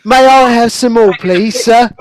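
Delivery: high, loud, hammed-up British pleading; mimicked British orphan. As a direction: echo the urchin's plea in mock British. Echoes the orphan plea, laughing.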